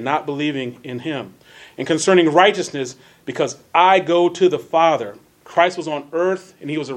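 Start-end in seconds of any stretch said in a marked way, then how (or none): 1.8-5.2 measured reading with key words punched; emphatic, well-enunciated Bible reading; deliberate scripture reading. Real, not acted. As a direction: read scripture out with careful elocution. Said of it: "that's emphasizing certain words".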